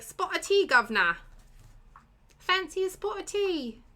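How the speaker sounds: high, mock-posh British greeting; hammed-up British accent. Teasing Liam as they used to, hammed-up British accent. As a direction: put on an over-the-top British tea-offer voice. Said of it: "my attempts at a British accent".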